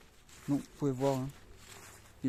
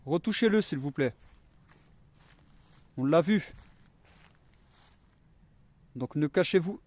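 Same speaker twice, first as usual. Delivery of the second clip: calling out loud; 3 semitones higher, 8 dB louder than usual. Real, not acted. Calling out to spirits.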